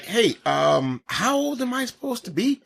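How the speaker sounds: high, quiet, puzzled question; imitating a nervous young actor asking his age; mock confused kid. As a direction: ask it like a lost young actor.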